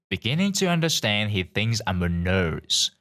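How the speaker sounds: plain reading voice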